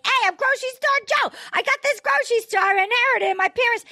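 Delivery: high, fast Chicago accent impression. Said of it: "he had a thick Chicago accent".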